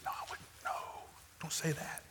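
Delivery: hushed inner plea, barely voiced; panicked whisper. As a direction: whisper it like silently begging him to stop. Speaker's inner panicked thought.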